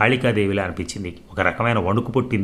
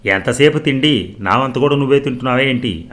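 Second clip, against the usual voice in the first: narrated husband yelling, raised pitch and volume; angry shout; 4 semitones higher, 5 dB louder than usual. A narrator's reading. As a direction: scold loudly, higher and stretched out. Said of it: "అని అరిచి".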